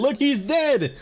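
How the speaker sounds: can you believe it, delighted; gleeful high "he's dead"; excited. Real, not acted. Boss evaporated by item.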